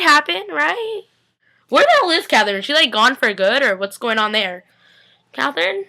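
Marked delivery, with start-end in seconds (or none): 5.3-5.8 high, soft mock-longing call; mock sweet call. Fan teasing the missing Catherine, soft mock sweet call. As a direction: coo it teasingly to the absent Catherine.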